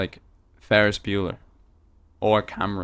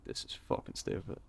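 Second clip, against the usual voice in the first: whispered aside; 9 semitones lower, 17 dB quieter than usual. Retold or imitated. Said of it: "I'd be like".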